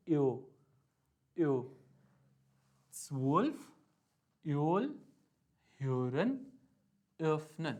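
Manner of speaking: यो as quick ee-o, kept in स्वोल्फ, योल, ह्योरन, öffnen; rounded gliding vowel in each word from यो to öffnen; O umlaut sound. Shown as a demonstration. Teacher drilling O umlaut examples, deliberate word list. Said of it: "second pronunciation है".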